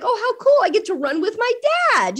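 mock excited kid. Mom imagining what a typical kid would say, mock-excited. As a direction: pitch way up like a thrilled kid. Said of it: "most kids would be like".

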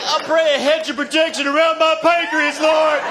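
building from earnest plea to shouting; fervent prayer to shouting. Acted.

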